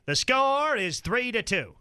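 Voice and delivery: mock radio commentary voice; sports broadcaster. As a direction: parody a sportscaster with stretched key words. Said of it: "his idea of the perfect broadcast would be this".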